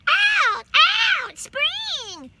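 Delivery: put-on very high, loud baby shout. A narrator's reading. Baby shouting to her brother and sister, very high and loud. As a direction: shout it out with glee. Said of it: "she shouted".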